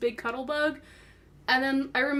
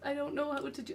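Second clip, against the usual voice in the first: tiny, halting voice trailing away; flustered stammer; 3 semitones higher, 11 dB quieter than usual. Retold or imitated. Fan to voice actor, stammering very softly. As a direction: apologise almost inaudibly, lost for words. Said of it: "I talked like that".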